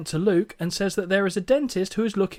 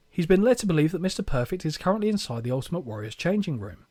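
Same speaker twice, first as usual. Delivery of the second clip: usual speaking voice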